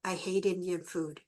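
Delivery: low and soft, a quiet "I hate Indian food"; softly spoken husband refusing her; gentle flat refusal. Retold or imitated. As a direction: say it low, mild and plain. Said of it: "And Fred goes".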